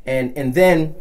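ordinary delivery

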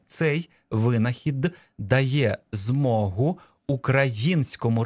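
deliberate, word by word; slow dictation. Shown as a demonstration. Teacher reading the next dictation sentence, slow and segmented. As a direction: dictate with a gap after each word. Dictation phrase read out slowly.

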